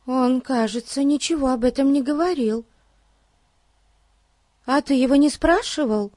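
put-on high-pitched child character voice; very high, inquisitive child; curious child. A narrator's reading. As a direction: wonder aloud in a high young voice.

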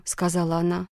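ordinary delivery, nothing marked

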